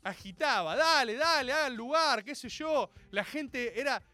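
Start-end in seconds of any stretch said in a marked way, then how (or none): 0.8-2.2 loud, agitated driver urging people on; chofer muy sacado. Retold or imitated. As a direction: holler it like a frantic bus driver. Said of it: "el chofer estaba muy sacado". (chofer sacado, apurando a los gritos)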